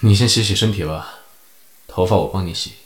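low, resigned amused voice; helpless chuckle. A narrator's reading. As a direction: say it with a fond sigh. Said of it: "零六幺无奈笑道".

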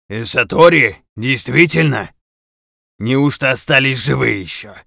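loud, otherworldly, astonished voice; amazed shaman. Acted. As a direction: marvel like an ancient spirit. Shaman voice, surprised.

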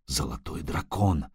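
hushed, low, awed murmur; whispered. A narrator's reading. Sorcerer beholding a living legend, whispering. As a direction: drop to a soft, wondering whisper. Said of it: "шепнул Драгорай".

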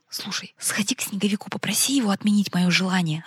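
urgent pleading whisper; whispering on the phone. Acted. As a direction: beg in a hushed whisper. Boy whispers a plea on phone. (умоляющий шёпот в трубку)

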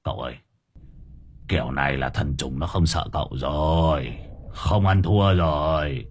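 low-pitched muttered complaint; grumbling. Acted. Sidekick doubts the master's power from behind him, grumbling. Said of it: "miệng nó lầu bầu".

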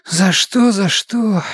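a hint of a sulky young woman; muttering girl. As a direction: grumble the words. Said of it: "забормотала Софья".

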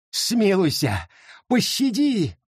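high, rising desperate plea; begging for mercy. Acted. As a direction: plead for his life. Garrett begs for mercy.

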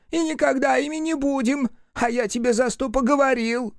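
high, squeaky little creature; shrill imp voice. A narrator's reading. Imp Пепелюха answers Рух in a high squeal.

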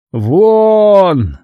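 high, loud bellowed word. Acted. Cardinal screams the guards out again, loud high bellow. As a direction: blast it at the hesitating guards. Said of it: "заорал он снова".

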